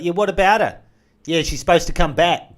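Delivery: high, loud shouted quote; loud calling. Retold or imitated. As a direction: call it out loudly. Greeks yelling across in story.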